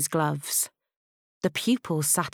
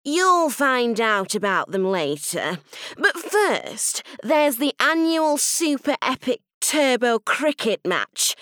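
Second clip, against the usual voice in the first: high-pitched headmistress announcing to the hall; grumpy school head making an announcement; imperious new headteacher; 4 semitones higher than usual. Acted. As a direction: proclaim it high, falling away at the end. Missus Clamp addresses the students.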